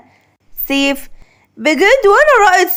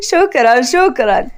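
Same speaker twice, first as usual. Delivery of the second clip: laughing; 2 semitones higher than usual. Acted. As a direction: laugh it off, lightly amused. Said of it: "مهند بضحك".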